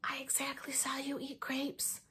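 soft, slow whisper; hushed child whisper. Acted. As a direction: whisper it like a secret. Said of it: "I made my voice very whispering".

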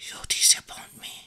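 hushed, voiceless whisper. A narrator's reading. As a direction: whisper it close to the listener's ear. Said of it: "She whispered".

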